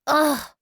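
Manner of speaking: loud frustrated ugh; exasperated groan. Acted. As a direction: groan out in annoyance.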